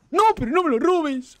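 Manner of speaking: shrill, frantic shout; panicked yell. Real, not acted. Teammate steals his kill.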